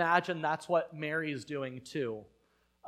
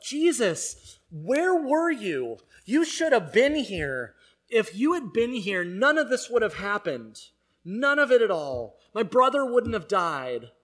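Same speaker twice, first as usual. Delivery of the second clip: loud, higher, accusing cry of grief; grieving sister confronting Jesus, loud and higher; upset and angry; 5 semitones higher, 8 dB louder than usual. Retold or imitated. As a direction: confront him in the street, hurt and furious. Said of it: "She's upset. She's angry. She's sad".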